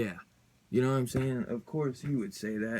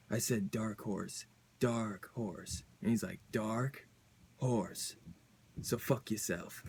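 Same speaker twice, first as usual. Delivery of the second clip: slow, quiet and threatening, softer; 6 dB quieter, slower than usual. Retold or imitated. Villain quietly threatening his rival in the comic, slow, soft menace. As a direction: lean in and drop to a menacing hush.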